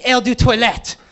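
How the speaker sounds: mock French perfume pitch; a touch of French salesgirl; fake French. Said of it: "She's like".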